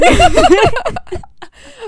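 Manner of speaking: loud squealing laugh; high burst of laughter. Real, not acted. Laughing at Tiger King puzzle.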